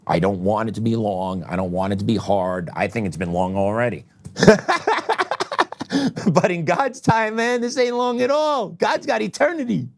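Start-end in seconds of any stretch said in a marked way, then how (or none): 4.4-10.0 laughing. Real, not acted. Laughing at his own long wait.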